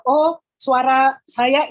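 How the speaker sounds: normal talking voice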